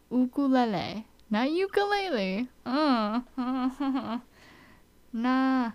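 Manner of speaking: usual speaking voice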